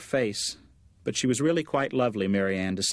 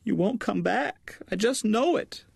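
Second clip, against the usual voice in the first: crying mother; 11 semitones higher than usual. Acted. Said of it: "Her mother began to cry".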